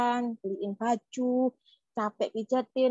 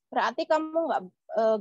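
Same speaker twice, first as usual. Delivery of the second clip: usual speaking voice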